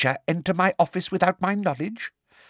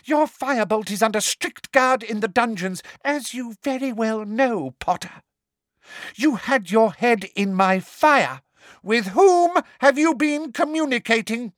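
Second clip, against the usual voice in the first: high, loud demanding; angry interrogation; 13 semitones higher, 3 dB louder than usual. A narrator's reading. Umbridge interrogates Harry, harsh and loud.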